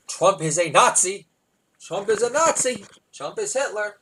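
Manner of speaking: high, loud alarmed outcry; hammed-up protester's rant; mock ranting. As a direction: cry it out, climbing in pitch. Mocking [name]'s political outbursts.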